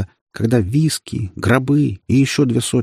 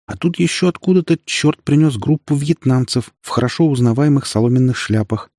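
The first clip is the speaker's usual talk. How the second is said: plain reading voice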